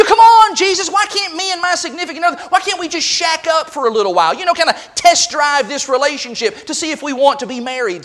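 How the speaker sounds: high, hurried mock pleading; whining. Believer haggling with Jesus over living together, whining. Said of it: "we want to whine".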